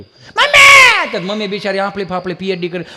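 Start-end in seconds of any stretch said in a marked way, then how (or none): 0.0-0.6 very high shouted call for mummy; child's wail. Acted. Fallen child yelling for his mother. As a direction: scream for mum like a kid who fell.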